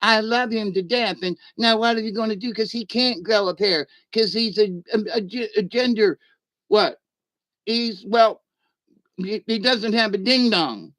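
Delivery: a hint of a whiny, flustered kid pleading with his dad; mild mock complaint, pitched a bit up; half-hearted son's protest to his father; lightly voiced upset son. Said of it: "And he was like".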